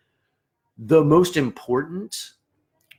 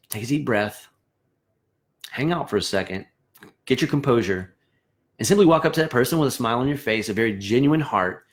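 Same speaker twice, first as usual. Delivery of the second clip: ordinary delivery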